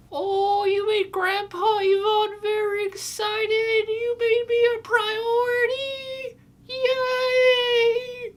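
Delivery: put-on very high, slow, teary old voice; squeaky elderly joy near tears; happy grandparent. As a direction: gush like a delighted grandpa.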